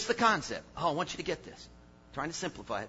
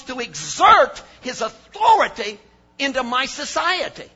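loud preaching, big falling emphasis on key words; full-force preaching; 8 semitones higher, 11 dB louder than usual. Acted. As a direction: preach it to the back row, hammering each point. Preacher driving home his point.

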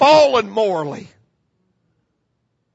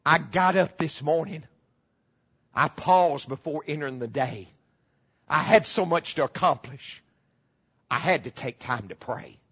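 softer, lower closing of the recitation; quiet poem ending; 8 semitones lower, 5 dB quieter than usual. Acted. Preacher closes the prayer poem to the congregation, soft and low.

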